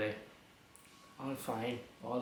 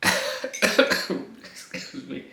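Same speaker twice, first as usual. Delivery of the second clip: coughing; 4 semitones higher, 12 dB louder, much slower than usual. Real, not acted. Coughing fit.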